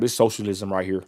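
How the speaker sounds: normal talking voice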